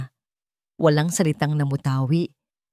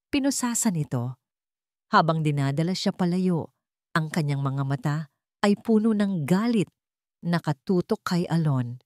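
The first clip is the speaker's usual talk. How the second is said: ordinary delivery, nothing marked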